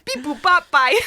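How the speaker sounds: high beeping robot. Pretend. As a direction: chirp it like a robot. Robot sign-off noise.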